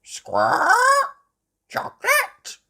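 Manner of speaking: very high, loud parrot screech; parrot mimicry. Polly copying Susie Sheep, squawky parrot voice. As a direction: squawk and echo it sharply. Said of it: "Polly Parrot copied".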